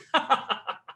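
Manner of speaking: high delighted chuckle; old man laughing at his own joke; gleeful laugh. Acted. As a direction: laugh with glee.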